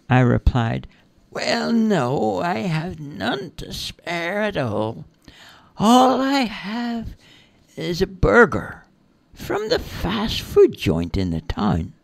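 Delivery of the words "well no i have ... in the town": high, slow, drawn-out character voice; Peggy, coyly refusing. Acted. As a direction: stretch out the refusal in a raised lilt. Peggy answers the fairy.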